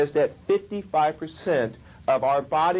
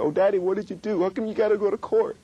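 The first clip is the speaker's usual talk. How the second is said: higher and quick, a hint of a child; retold crying daughter; 8 semitones higher, faster than usual. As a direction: lightly imitate a worried little girl. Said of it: "when she started crying".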